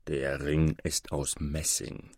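low, slow, soft explanation; erklärender Maure. A narrator's reading. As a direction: correct him quietly and unhurriedly. Moor corrects the boy. (leise, tief und langsam erklärend)

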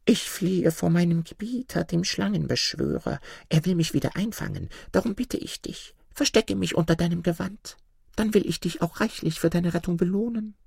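pleading snake. Acted. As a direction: beg him to hide you. Snake begs a man.

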